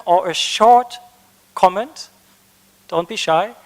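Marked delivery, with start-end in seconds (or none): none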